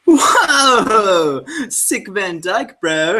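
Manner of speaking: loud, high, drawn-out mock awe; hyped yelling at cats. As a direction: gush like a hyped bro over a cat. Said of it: "Just yelling at people's cats".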